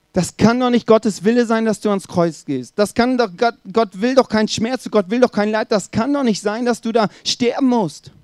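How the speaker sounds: high, agitated pleading objection; urgent protest. Acted. Peter to Jesus before the cross, protesting urgently.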